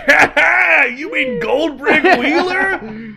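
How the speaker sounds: big, high, laughing guffaw; John Goodman type. Acted. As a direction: crow it loudly through mocking laughter. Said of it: "this John Goodman ass motherfucker is just like".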